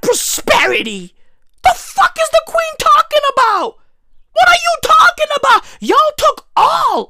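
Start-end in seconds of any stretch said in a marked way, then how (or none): 1.6-7.1 outraged high shout; incredulous shout. Real, not acted. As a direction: shout it in disbelief. Incredulous rant at the Queen.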